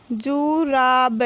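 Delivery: slow, stretched जु, रा, ब, falling at the end; drawn-out teaching reading of जुराब; syllable by syllable. Shown as a demonstration. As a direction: sound out जुराब piece by piece. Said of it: "तो हम इस प्रकार पढ़ेंगे".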